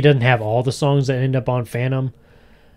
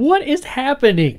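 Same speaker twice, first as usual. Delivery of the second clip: much higher bewildered friend; high mock voice; 13 semitones higher than usual. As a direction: squeak out the question in disbelief.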